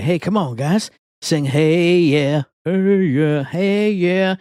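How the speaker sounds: imitated lounge singer's call; crowd-rousing singer. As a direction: get the crowd singing along. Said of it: "trying to kinda get the crowd into it".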